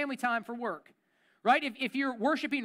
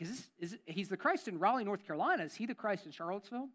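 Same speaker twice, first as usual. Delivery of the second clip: doubtful inner voice; 4 semitones lower, 7 dB quieter, faster than usual. Retold or imitated. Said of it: "it was like".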